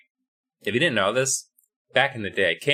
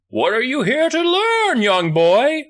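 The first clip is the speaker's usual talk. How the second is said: high, drawn-out wizard's question; hammed-up wizard addressing his pupil; theatrical wizard; 11 semitones higher, 3 dB louder, slower than usual. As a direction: proclaim it like a hammy wizard, pitched way up. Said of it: "the wizard's just like".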